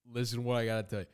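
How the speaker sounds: ordinary delivery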